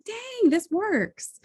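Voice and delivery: high and slow, savouring it; slowly drawn-out high amazement; amazed delight. Retold or imitated. Staring at the bottle, amazed and slow.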